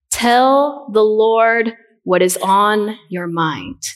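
ordinary delivery

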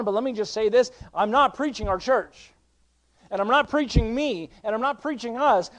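fervent sermon delivery, punching key phrases; impassioned preaching. Real, not acted. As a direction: declare it with conviction to the congregation. Preaches at full force.

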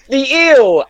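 high, loud mock exclamation; retold comment. Retelling a friend's group chat comment, high exclamation. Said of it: "Carter just commented".

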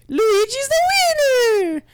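very high, loud drawn-out cartoon cheer; Luigi. Game character declaring victory, high stretched triumphant voice. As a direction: crow it slowly in a shrieky Italian character voice. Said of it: "then it turns into".

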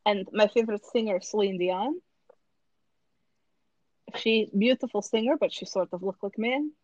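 put-on deadpan Russian-accented character. Auditioning for American Idol in character, Russian accent. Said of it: "I rap with a Russian accent".

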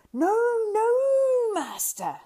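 high, loud creature wail; Gollum wailing. A narrator's reading. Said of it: "wailed Gollum".

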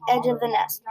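ordinary delivery

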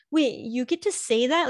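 husband surprised. Retold or imitated. Husband hears wife say it to daughter, mock indignant surprise. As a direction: play his disbelief. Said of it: "Sean's always like".

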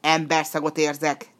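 loud, suspicious demon outburst; mildly hammed-up furious demon; fierce shout. A narrator's reading. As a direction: burst in as an enraged monster. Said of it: "Kiáltotta bőszen".

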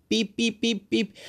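high, even beeps; imitated beeping. Brain doing backflips, high imitated beeping. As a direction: pip it out high like a machine.

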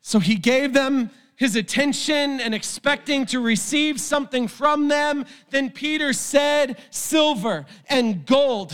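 high, impassioned preacher voice, often shouting; full-force preaching. Acted. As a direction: preach it with fire to the crowd. Preaching to congregation, then podcast outro.

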